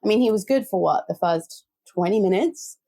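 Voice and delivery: usual speaking voice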